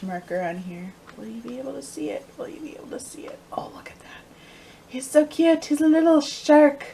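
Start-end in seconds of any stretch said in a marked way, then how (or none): 1.2-4.2 quick, whispery murmur to yourself; soft, hurried half-whisper; hushed aside. Real, not acted. Murmuring while showing tiny marker. 4.9-6.8 high, loud cooing over something tiny; delighted squeal of affection; cutesy gushing. Real, not acted. Gushing over cute stitch marker.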